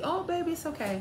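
high, soft, soothing nurse voice; sweet reassurance. Retold or imitated. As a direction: gently coo it like a sweet nurse. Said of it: "she was so sweet".

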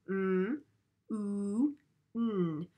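"mm", "oo", "n" said as three separate held sounds with pauses between; segmenting sounds. Shown as a demonstration. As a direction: split moon into its sounds: "mm", then "oo", then "n". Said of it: "Can you break apart for me the word moon".

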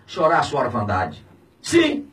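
ordinary talk

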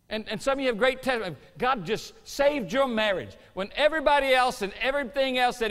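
loud, high, ringing preacher's build; preaching at full force. Acted. Preacher rousing the congregation about answered prayer, loud and ringing. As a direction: preach it out with rising fire.

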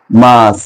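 মার্চ with the r left silent; British pronunciation. Shown as a demonstration. Teacher answering what they say, British pronunciation. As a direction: stretch the vowel of মার্চ, no r. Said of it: "সেটা বলে কি".

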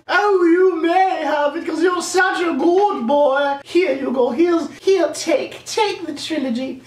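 high, slow domineering queen; evil queen voice. Pretend. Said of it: "like the queen".

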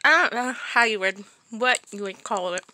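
talking around hard candy; slight mouth-full speech; sucking on candy. Real, not acted. Seen 1: cheek bulge and pursed, sucking lips around speech; candy tasting.

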